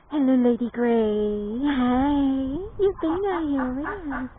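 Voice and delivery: loud, high, slow pet greeting; excited greeting. Real, not acted. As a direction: sing it out to the bird.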